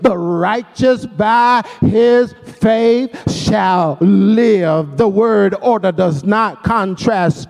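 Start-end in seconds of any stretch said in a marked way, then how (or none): 0.0-5.0 slow reading, landing hard on SHALL LIVE; deliberate verse reading, weight on "shall live"; emphasis on SHALL LIVE. Shown as a demonstration. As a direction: stretch it out and set "shall live" apart. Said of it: "we should read this verse like this".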